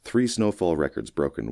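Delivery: ordinary delivery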